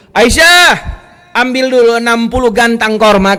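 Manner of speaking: loud, high call to someone in another room. Retold or imitated. Prophet calls wife to fetch dates, calling out loudly. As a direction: holler the name, then give the order.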